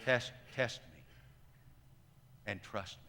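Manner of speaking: low, hushed invitation to trust; quietly spoken words of Jesus; quiet gentle urging. Retold or imitated. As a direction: say it softly and low, with space between phrases. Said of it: "But Jesus just quietly says".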